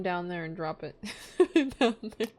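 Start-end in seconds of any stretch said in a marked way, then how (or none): 1.4-2.4 high giggles round words; laughing. Real, not acted. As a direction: laugh through it. Laughing at friend.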